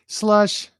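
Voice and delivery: slush. Acted. Poem's squish sound words.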